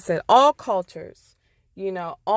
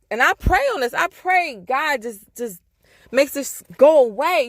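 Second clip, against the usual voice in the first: ordinary delivery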